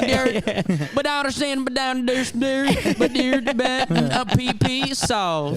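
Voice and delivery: high, garbled mock Southern babble; exaggerated Southern mumbo-jumbo; incomprehensible Southern accent. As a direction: mangle the words like a thick-accented Southern heckler. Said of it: "you barely spoke any English".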